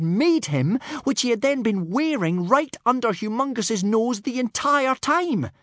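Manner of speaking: high, loud, indignant telling; outraged. A narrator's reading. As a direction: build in outrage to the last word. Narrator voices hero's outrage at betrayal.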